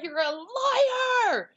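high, slow, drawn-out yell; retold shout. Nine-year-old yelling at the woman, long high shout. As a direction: fling the accusation out long and high. Child rejects news of parents' death.

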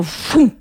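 quick "phoom" of a sudden force; imitated whoosh. Showing the force that threw her down, whoosh. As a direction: mimic a force rushing in. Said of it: "I just felt this force go".

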